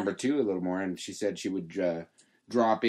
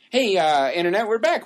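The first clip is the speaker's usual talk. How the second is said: podcast host; 8 semitones higher, 7 dB louder than usual. Real, not acted. Host welcomes listeners back.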